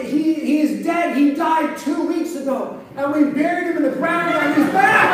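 loud, high-pitched comic hysteria; hammed-up panic, building to the punchline; mock screaming. Neighbor explains the buried rabbit to the woman, mock screaming. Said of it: "the neighbor screams".

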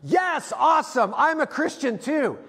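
sudden high shout of yes, then bright; excited. Retold or imitated. Brown belt to beginner, hammed-up excited shout. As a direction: burst out in relief like a fan. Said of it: "he goes".

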